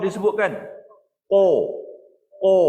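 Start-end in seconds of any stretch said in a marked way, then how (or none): 1.3-2.6 "ko" over-thick and loud, whole tongue raised; qaf terlalu tebal. Shown as a demonstration. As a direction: overdo "ko" with a heavy rounded qaf. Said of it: "Itu tak betul". (sebutan "ko" terlalu tebal, semua lidah naik)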